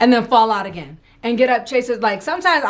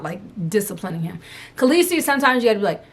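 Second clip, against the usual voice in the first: usual speaking voice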